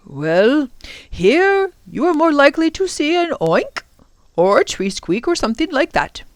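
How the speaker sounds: lilting professor, swooping up mid-sentence; amused professor. Acted. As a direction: answer in a playful professorial lilt. Professor answers Lindy.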